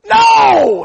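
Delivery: huge high drawn-out shout; teacher's roar. Retold or imitated. Teacher rejecting a student's answer, roaring. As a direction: bellow the refusal at the class.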